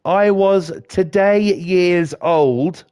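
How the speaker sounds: slow, drawn-out mocking quote; mocking the meme. Mocking the social media phrase, slow and drawn out. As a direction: stretch it out to mock the phrase. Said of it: "and that's like".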